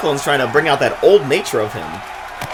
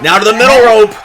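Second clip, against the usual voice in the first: excited; 5 semitones higher, 6 dB louder than usual. Real, not acted. Climbing the ropes.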